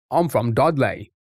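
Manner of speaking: slow put-on West Midlands accent; Dudley accent. Streamer pretending to be a local, Dudley accent. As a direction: play a Dudley local, badly. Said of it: "Is that how people from".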